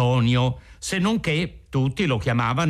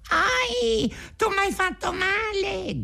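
very high, squeaky yelp of pain; little voice crying out; 3 dB quieter, slower than usual. A narrator's reading. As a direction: squeal a pleading complaint in a tiny voice. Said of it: "gridò raccomandandosi la solita vocina".

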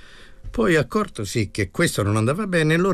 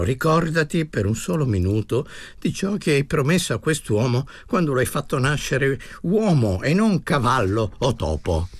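plain reading voice

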